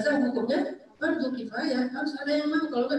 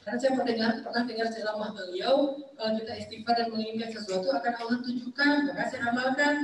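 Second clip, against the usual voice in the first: ordinary delivery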